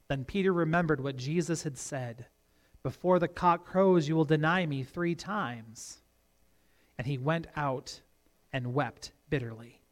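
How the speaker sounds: soft, low, hushed close to a Bible passage; somber scripture reading. Acted. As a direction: let the reading sink quietly, with grave pauses. Reads somber end of passage.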